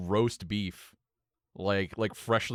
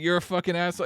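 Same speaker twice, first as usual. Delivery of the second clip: usual speaking voice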